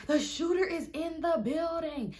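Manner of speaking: high, slow panicky whine; mock alarm. Storyteller warning her friend about shooter, high mock alarm. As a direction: warn in exaggerated dread.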